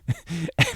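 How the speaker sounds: sudden high burst of laughter. Real, not acted. Host laughing at the listener's story. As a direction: crack up mid-sentence.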